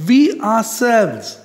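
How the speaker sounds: "we ourselves" high, slow and weighty; stress on we ourselves. Shown as a demonstration. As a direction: stretch "we ourselves" for attention. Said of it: "we are putting a emphasis".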